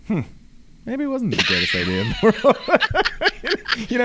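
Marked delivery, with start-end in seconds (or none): 0.1-2.6 high, laughing and rueful. Retold or imitated. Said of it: "I was like". 2.6-3.8 high laugh. Real, not acted. Laughs at own story.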